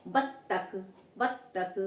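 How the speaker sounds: बत्तख with lips pressed together; बत्तख, lips meet on the ब. Shown as a demonstration. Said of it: "हमारे दोनों होंठ मिल रहे हैं". (बत्तख में होंठ मिलाकर ब)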